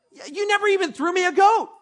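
high, loud whining complaint; whiny outrage. Acted. Older brother whining at his dad about a goat, loud high whine. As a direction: throw the grievance at dad like a sulking kid. Preacher voices the sulking brother.

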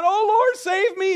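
high, loud cry for help; fearful cry. Retold or imitated. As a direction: act out Peter's panicked plea as he sinks. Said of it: "He gets full of fear".

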